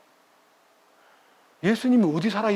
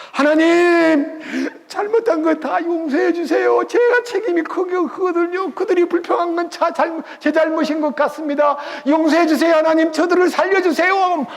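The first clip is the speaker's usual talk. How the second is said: higher, louder begging prayer; pleading Moses; 10 semitones higher, 3 dB louder than usual. Retold or imitated. Moses clinging to God, pleading for the people, higher and loud. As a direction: beg God desperately for the people. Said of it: "막 매달리니까".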